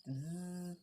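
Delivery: soft, imitated bzz; bee buzz. A narrator's reading. The bee buzzes near the donkey's ears, soft buzz. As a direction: hold a quiet buzzing z. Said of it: "and buzzed".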